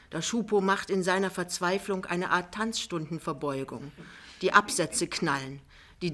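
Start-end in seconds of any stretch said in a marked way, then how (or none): none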